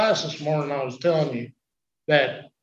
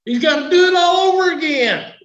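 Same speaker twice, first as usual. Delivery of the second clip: high, loud shouting sermon climax; preaching at full force; 14 semitones higher, 7 dB louder than usual. Acted. Preacher shouts to his congregation, full-force preaching. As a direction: belt it out to the congregation, much higher and louder.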